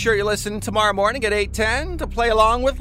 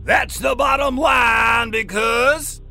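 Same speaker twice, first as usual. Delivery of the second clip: loud, slow, higher wrestler shout; Stone Cold impression; 6 semitones higher, 4 dB louder, slower than usual. Shown as a demonstration. Radio host doing Stone Cold's catchphrase for a quiz, loud slow shout. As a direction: bellow it like a pro wrestler cutting a promo. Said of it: "I'm gonna do it in his voice".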